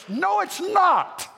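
sudden high, slow emphatic No, it's not; preaching shout. Real, not acted. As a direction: cry it out from the pulpit, slow and high. Preacher rebuts complacency.

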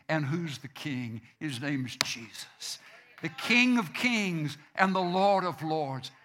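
ordinary delivery

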